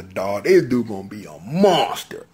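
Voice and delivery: ordinary talk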